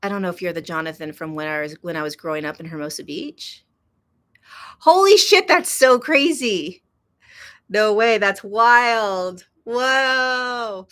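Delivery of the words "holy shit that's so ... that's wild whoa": loud, high-pitched amazement, words stretched out; real blown-away surprise at a small world; can't believe it, drawing out the words; astonished. Real, not acted. Recognizes an old friend in chat.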